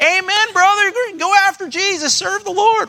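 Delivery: mock high-pitched churchgoer cheering on; hammed-up falsetto amen, brother; cheering church folk. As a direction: lift way up like an overjoyed congregation member. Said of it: "You'd think that people are like".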